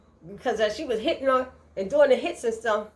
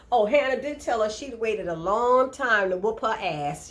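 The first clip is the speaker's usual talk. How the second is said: ordinary delivery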